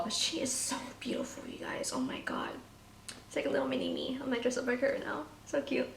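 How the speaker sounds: hushed, breathy gush; whispering. Real, not acted. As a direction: whisper it in awe. Gushing at the doll up close.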